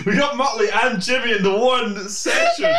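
high laughing, barely speaking; helpless laughter. Real, not acted. Laughter fit.

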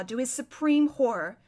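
plain reading voice

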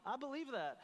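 high, intrigued "huh"; mock self-discovery. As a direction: act out catching yourself believing something. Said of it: "look at the things that you believe and go".

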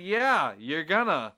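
loud, high mocking yeah; mock-loud sarcastic agreement; sarcastic retort. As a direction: scoff back at the landlord. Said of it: "I was like".

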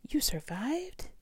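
high, drawn-out amazed question; breathy, high storybook amazement; astonished cry. A narrator's reading. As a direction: stretch it slowly, rising in disbelief. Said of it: "cried Stella Luna".

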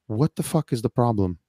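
ordinary talk, nothing marked

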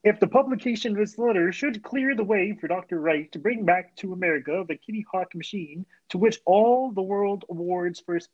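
light, lightly voiced frog puppet; hint of a Kermit impression. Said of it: "is that Kermit the Frog?".

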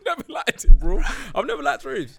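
laughing while denying; cracking up mid-denial. Real, not acted. Laughing denial about raves.

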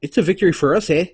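mock Canadian tossing off a proud eh; bad Canadian accent. Podcast host mimics Canadians claiming victory, light Canadian accent. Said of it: "which is a terrible Canadian accent".

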